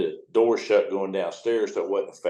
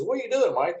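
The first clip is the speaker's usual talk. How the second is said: put-on higher, friendly old boss on the radio; a high, chummy call over the radio; voicing Bill; 12 semitones higher than usual. Old boss calls to him over the radio, higher chummy voice.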